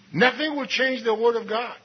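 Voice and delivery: usual speaking voice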